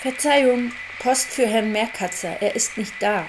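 loud pretend postman. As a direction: announce it loudly at the intercom.

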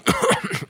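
sudden choking cough; coughing fit. Real, not acted. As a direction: cough on a crumb. Chokes on gingerbread.

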